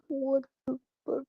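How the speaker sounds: high, whimpering cry of disbelief; panicked squeal. Retold or imitated. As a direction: squeak it out, half crying, in panic. His panicked reaction, retold.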